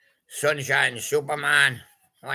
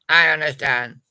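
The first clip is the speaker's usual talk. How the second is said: said out firmly and louder; louder emphatic take; 7 dB louder than usual. Shown as a demonstration. Second take to the patient, firm and louder. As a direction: insist on it.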